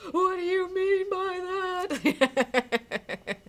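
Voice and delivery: long, high, hammed-up wail; desperate cry. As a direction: call out in drawn-out mock despair, breaking into laughter. Acting Adrian's desperate cry after John.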